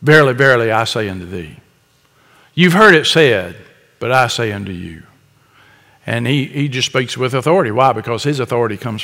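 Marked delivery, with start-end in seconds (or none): 0.0-5.0 solemn Jesus. Retold or imitated. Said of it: "Jesus would just say".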